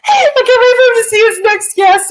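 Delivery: high, loud gleeful outburst; teasing the departed guest, loudly gleeful; excited shout. Real, not acted. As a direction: shout it with delight at his misfortune.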